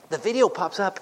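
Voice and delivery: usual speaking voice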